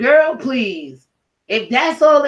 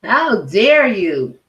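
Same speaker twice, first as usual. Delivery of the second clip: very slow, heavy, drawn out; slow indignation; much slower than usual. Real, not acted. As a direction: let each word land in disgust.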